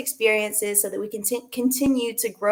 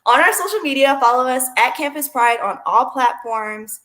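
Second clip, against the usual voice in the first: YouTuber voice; 5 dB louder than usual. Pretend. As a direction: pump it up like a YouTuber. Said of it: "I'm gonna turn on my YouTuber voice and say".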